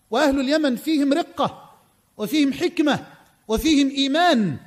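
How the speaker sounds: ordinary talk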